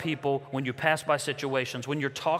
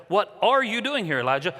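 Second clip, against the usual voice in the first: "are" pushed higher and louder; stress on ARE; 5 semitones higher, 3 dB louder than usual. Shown as a demonstration. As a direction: lean hard on "are". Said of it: "whatever word you emphasize".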